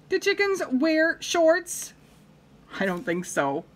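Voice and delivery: ordinary delivery, nothing marked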